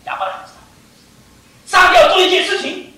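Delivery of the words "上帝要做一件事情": loud, high, full-force proclamation; preaching shout. Real, not acted. Preacher announcing God's plan, shouting. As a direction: shout it to the congregation.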